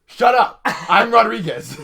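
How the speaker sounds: high, loud outburst at being corrected; indignant shout. Real, not acted. Shouting back at the co-host who teased about confusing the listeners, loud and high.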